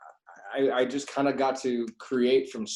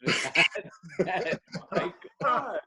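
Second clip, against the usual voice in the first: much higher, laughing through it; overwhelmed, laughing disbelief; real delighted surprise; 10 semitones higher than usual. Real, not acted. Actor meets a long-lost co-star, laughing in surprise.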